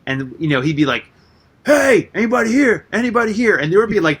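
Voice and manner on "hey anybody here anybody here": raised, higher call of a landlord hunting for tenants. Retold or imitated. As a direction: call into the rooms like a landlord after rent. Landlord calling for hidden tenants.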